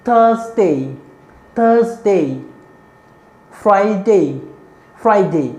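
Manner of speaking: teacher drilling the days of the week; slow drill. Shown as a demonstration. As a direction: recite the days slowly, twice each. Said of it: "एक बार फिर से देख लेते हैं".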